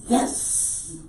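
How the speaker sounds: high, whispery celebratory yes; stifled squeal of victory; hushed triumph. Retold or imitated. Artist reacting to buyer giving in, hushed elation. Acted private triumph at the sale.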